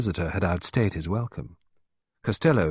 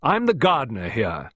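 higher, hearty introduction; robust reply; 5 semitones higher, 4 dB louder than usual. A narrator's reading. Miss Peake introducing herself to Costello, robust. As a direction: state the job robustly. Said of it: "she replied robustly".

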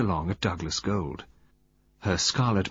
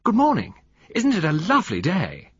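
high, bright-pitched call, louder at first; 9 semitones higher, 5 dB louder than usual. A narrator's reading. Greeting the group on the beach, called out high. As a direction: call a cheery greeting across. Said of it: "called out".

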